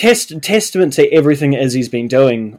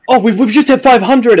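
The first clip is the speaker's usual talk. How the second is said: excited update; 8 semitones higher, 5 dB louder than usual. Retold or imitated. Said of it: "being like".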